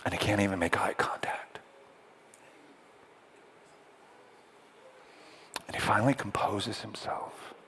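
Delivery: soft, low, with long pauses; hushed narration. A narrator's reading. Preacher introducing the last grieving messenger, hushed. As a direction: let the dread hang in near-whispers.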